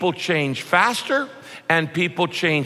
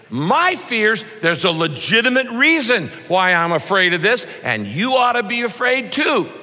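higher, louder, sure of your own fears; self-righteous insistence; 6 semitones higher, 4 dB louder than usual. Retold or imitated. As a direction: insist your fears are the reasonable ones. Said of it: "that's the way we feel".

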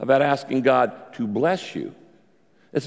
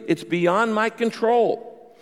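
slow pleading; 8 semitones higher, much slower than usual. Shown as a demonstration. Voices hopeless prayer.

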